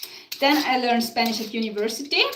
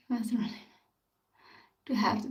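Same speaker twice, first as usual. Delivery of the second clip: breathy whispered aside, barely voiced; 8 dB quieter than usual. Real, not acted. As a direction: drop to a secret whisper between laughs. Giggling aside while talking live.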